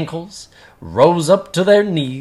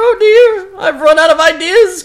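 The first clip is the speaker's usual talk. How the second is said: higher and louder falling oh dear; despairing cry; 14 semitones higher, 6 dB louder than usual. Acted. Gabu has run out of ideas, higher louder despairing cry. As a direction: give up in dismay. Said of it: "cried Gabu".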